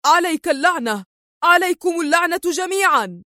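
high-pitched angry curse; outraged outburst; enraged cry. A narrator's reading. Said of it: "يصرخ في ثورة".

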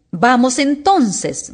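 high, drawn-out royal command; roared. A narrator's reading. Queen summons Alicia to play, roaring. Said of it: "rugió la reina".

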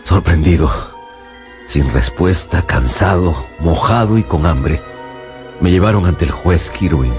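very low, drained voice; heavy, exhausted low register; worn-out narration. Acted. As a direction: tell it wearily, bone-tired and soaked. Exhausted accused man narrates.

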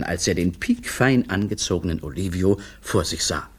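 ordinary delivery

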